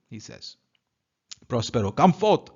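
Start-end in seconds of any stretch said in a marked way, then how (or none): 1.9-2.6 loud high summons; calling out. Acted. Master ordering his slave out, loud shout. Prospero summoning Caliban from cave.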